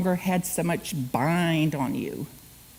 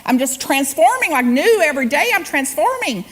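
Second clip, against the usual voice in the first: excited retelling; 5 semitones higher, 5 dB louder than usual. Retold or imitated. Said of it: "she said".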